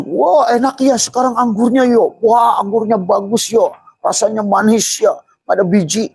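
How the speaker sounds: high, loud, giddy mock enjoyment; carefree delight. Preacher mocking a careless man enjoying grapes, giddy high voice. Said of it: "Tapi dia malah asik".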